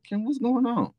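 mom's surprised question. Retold or imitated. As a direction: voice the mother who just walked in.

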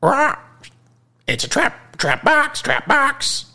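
very high, squawking parrot voice. Acted. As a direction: screech it like an excited parrot. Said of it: "the parrot squawked".

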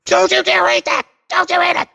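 hammed-up child tantrum; mock screaming child. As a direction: scream it like a furious five-year-old. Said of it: "he's screaming at me".

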